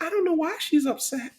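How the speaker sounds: high, put-on innocent girl voice; mocking coworker. Classmate handing over the phone, high mock-innocent voice. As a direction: play dumb in a squeaky voice. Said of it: "she's like".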